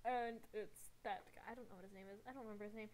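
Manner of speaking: usual speaking voice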